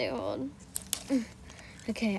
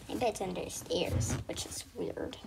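whisper; 5 semitones lower, 8 dB quieter than usual. Pretend.

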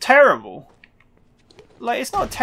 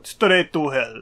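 bad Russian accent; 3 semitones lower, slower than usual. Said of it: "oh, that's a bad Russian accent".

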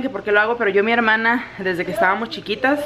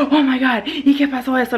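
higher, dramatic gasping storytime reaction; exaggerated storytelling; 5 semitones higher than usual. Shown as a demonstration. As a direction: ham up the shock for storytime. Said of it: "que exagero, que hago gestos".

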